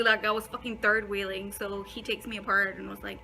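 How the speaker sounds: normal talking voice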